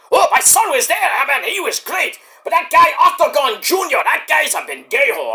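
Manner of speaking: excitable high-pitched proud dad voice; Eddie. Pretend. Proud father Eddie praising his son, excitable put-on voice. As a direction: put on a high, bragging character voice. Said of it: "right, Eddie? That's your son".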